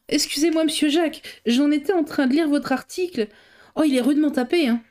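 a brave gossipy housekeeper, flustered but friendly. A narrator's reading. Housekeeper apologizing to the journalist, chatty and flustered.

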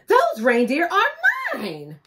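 high, slow, loud outcry; cried out. A narrator's reading. Santa crying out over his reindeer, loud and high. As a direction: Santa bellowing his claim. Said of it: "he cried out".

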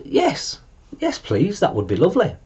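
high, soft eager yes; delighted polite yes. A narrator's reading. Dragons accepting Mia's game, high eager.

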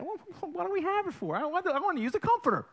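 high-pitched, hurried husbandly complaint; mock exasperated protest. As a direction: protest like a thwarted husband, played for laughs. Husband retelling a comforter dispute.

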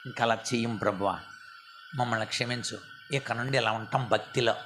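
low, soft prayer of repentance; humble repentance. Shown as a demonstration. Preacher models repenting to God, soft and humble. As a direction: promise God quietly to change. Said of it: "మనం మారు మనసు పొందాం".

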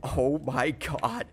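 ordinary talk, nothing marked

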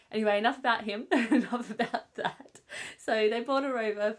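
brushing off the tears with a laugh. Real, not acted. Mum laughs off getting teary about her partner, laughing.

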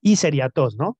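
ordinary talk, nothing marked

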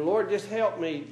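ordinary delivery

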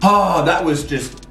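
talking while finishing a bite; savouring food, relaxed sigh; mouth full, chewing. Real, not acted. Seen 0: Ate candy at -4; head back, cheeks full until about +1.0.

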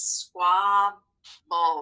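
"squabble" drawn out in two separated parts; split into syllables. Shown as a demonstration. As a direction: say "squabble" as squab, pause, bull. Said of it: "it's going to look like this".

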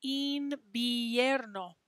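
invierno slowed, een-bee-EHR-no. Shown as a demonstration. Teacher spelling out invierno slowly for the class. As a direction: draw out invierno with both I's as ee. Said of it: "Two I's in that word".

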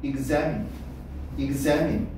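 examine의 가운데 a에 강세; examine을 '이그재민', '재'에 힘주어. Shown as a demonstration. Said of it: "액센트는 A 있지". (examine, 가운데 음절을 세게)

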